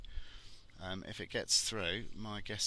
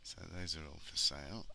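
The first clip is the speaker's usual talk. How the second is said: ordinary talk, nothing marked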